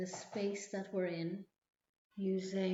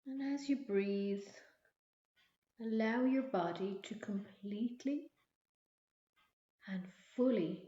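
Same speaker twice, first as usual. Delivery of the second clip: guided meditation. Acted. Leads a relaxation visualization.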